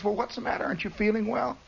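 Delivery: high, soft fussing mother; worried mother. Retold or imitated. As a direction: coax an answer out of the kid. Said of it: "she says".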